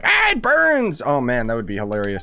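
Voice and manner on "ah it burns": high, squeaky cry of pain, hammed up; mock Paimon yelp. Imitating Paimon getting spat on, high mock yelp. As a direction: squeal it like a scalded little companion. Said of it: "and then she's like".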